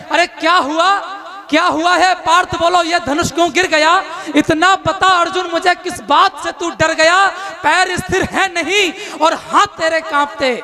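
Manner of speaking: rousing declaimed verse; epic poem recitation. Acted. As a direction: declaim it to a poetry crowd. Krishna's verse.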